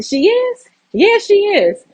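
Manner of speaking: high, sing-song realization; squeaky dawning surprise; mock surprise. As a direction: gasp up into it, then agree. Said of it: "I'm like".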